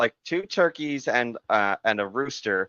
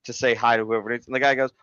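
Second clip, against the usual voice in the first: usual speaking voice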